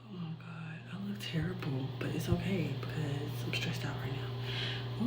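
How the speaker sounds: hushed whisper to the camera; barely voiced, breathy aside. Real, not acted.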